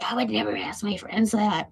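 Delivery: a mocking, put-on imitation of the miffed friend; mock mad friend. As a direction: parrot her line in a sneering voice. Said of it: "She was, like, mad at me".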